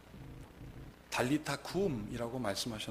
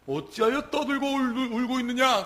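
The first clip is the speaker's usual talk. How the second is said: thundering rebuke; 10 semitones higher, 11 dB louder than usual. Acted. Jesus rebuking the wailing mourners, thundering rebuke. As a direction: rebuke the noisy mourners with raised voice. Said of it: "호통치십니다".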